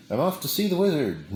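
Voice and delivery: put-on high, fast sing-song girl; chirpy storybook girl; Dorothy voice. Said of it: "In the words of Dorothy in The Wizard of Oz".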